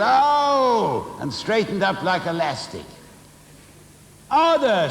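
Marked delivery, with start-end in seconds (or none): 0.0-1.2 sudden high yelp of pain, loud; a boy yelps when the cane lands; yelling Oh. A narrator's reading. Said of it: "he yelled". 4.3-4.9 high, loud shriek of a name; shrieking Missus Pratchett. A narrator's reading. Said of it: "shrieked a voice".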